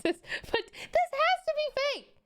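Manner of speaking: high, slow, stretched out in disbelief; can't believe it, drag it out high; stunned disbelief. Real, not acted. Streamer reading a post, high stunned disbelief.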